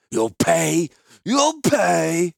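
loud, high repeated threat; quivering with rage. Acted. The merchant threatens Gerard, quivering with rage. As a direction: shake with fury on each word. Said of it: "He quivered with rage".

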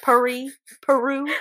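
Southern twang on "Paris"; Southern accent. Shown as a demonstration. Teasing each other about Southern vowels, Southern accent. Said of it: "Our Southern is showing".

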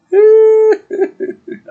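high, loud burst of real laughter; cracking up, can't hold it in; big laugh. Real, not acted. Podcaster cracks up retelling the episode, loud laugh. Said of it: "it's just so funny".

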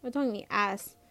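ordinary delivery, nothing marked

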